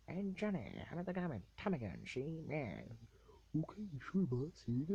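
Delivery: high, loud cartoonish gangster banter; old-movie mobster. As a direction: ham up an old New York mobster. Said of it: "the old mobsters that are".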